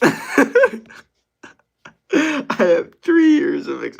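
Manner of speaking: slow, loud, cracking with laughter. Real, not acted. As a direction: laugh till the voice breaks. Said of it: "my voice is cracking a little bit".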